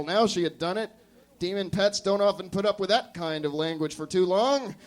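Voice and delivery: ordinary delivery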